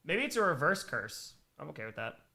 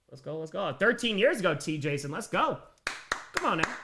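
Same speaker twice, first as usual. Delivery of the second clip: usual speaking voice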